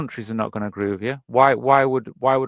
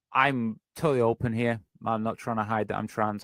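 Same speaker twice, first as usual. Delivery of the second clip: ordinary talk, nothing marked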